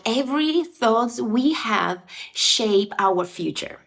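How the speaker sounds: ordinary talk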